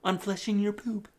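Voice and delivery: mock Trump boasting about toilets; altered-voice Trump; Trump impression. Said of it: "my Trump impression".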